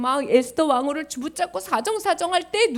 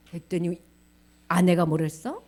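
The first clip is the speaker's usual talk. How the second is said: ordinary delivery, nothing marked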